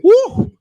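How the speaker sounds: sudden high loud whoop. Real, not acted. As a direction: let out a big excited woo. Whooping at his own point.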